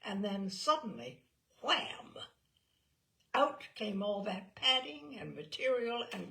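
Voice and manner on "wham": usual speaking voice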